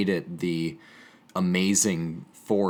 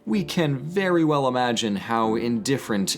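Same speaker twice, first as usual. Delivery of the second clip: dramatic poetry reading, pitched higher and building toward a grand climax; poem recital; 4 semitones higher than usual. Acted. Reading Cavafy's poem aloud to viewers, a rising, impassioned recital. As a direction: recite it like an ode, swelling with pride through the list of glories.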